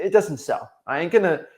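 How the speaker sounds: ordinary talk, nothing marked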